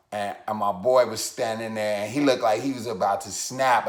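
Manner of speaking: normal talking voice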